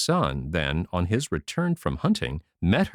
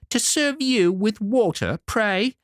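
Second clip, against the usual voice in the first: much higher, haughty retort; proud and saucy; 11 semitones higher than usual. Acted. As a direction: sneer at the lady. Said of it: "said the proud saucy one".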